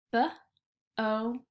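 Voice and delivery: B-O-T stretched sound by sound. Shown as a demonstration. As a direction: pull boat apart into its sounds. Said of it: "Can you stretch it on your hands?".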